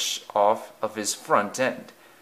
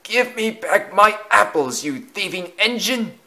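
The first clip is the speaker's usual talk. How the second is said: high, strained scolding vicar; angry cry; 10 semitones higher, 3 dB louder than usual. A narrator's reading. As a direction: shout after the thief in a high voice. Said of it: "he cried".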